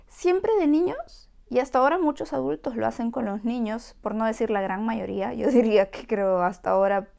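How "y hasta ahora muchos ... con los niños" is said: ordinary talk, nothing marked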